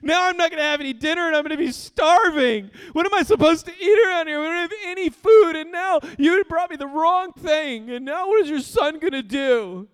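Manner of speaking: put-on high, loud whiny kid crying and shouting; tantrum child. Pastor imitating his son whining about a sandwich, high crying shout. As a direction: wail like a furious little boy over the wrong dinner. Son's tantrum over wrong dinner.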